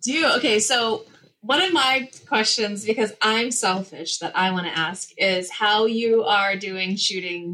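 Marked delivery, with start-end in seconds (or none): none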